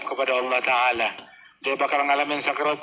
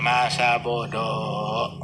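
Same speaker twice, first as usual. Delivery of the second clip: drawn-out, lower careless shrug; whatever; 5 semitones lower, much slower than usual. Retold or imitated. Brushing off gossip from others, slow lazy shrug. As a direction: drag it out like you couldn't care less.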